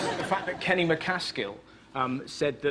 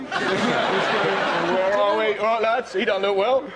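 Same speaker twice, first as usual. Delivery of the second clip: mock football fans; 2 semitones higher, 5 dB louder, slower than usual. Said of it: "I'll tell you what would be funny".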